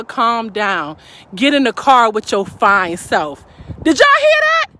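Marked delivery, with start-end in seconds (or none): 0.0-3.4 smooth sweet talk. Retold or imitated. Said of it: "he's telling her".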